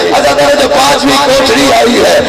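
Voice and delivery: ordinary talk, nothing marked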